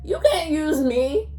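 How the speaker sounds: mock telling-off, voice lifted; indignant retort. Mock scolding of defensive boyfriend.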